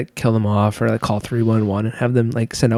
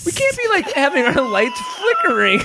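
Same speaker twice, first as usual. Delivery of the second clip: exasperated; 12 semitones higher than usual. Real, not acted. Ranting about flickering lights.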